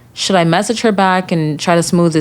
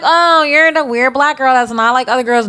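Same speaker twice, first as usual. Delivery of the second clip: mocking classmates; 6 semitones higher than usual. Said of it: "it's like".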